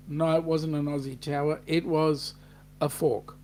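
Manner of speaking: usual speaking voice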